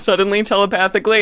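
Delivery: normal talking voice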